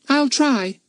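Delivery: put-on complaining little girl; a young girl grumbling, pitched high; little girl's voice. Bets answering.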